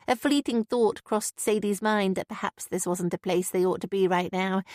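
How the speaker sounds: usual speaking voice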